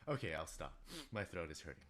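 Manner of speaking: ordinary talk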